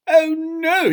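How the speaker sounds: very high, loud dismay; horrified gasp. A narrator's reading. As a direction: gasp it out in comic horror. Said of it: "he gasped".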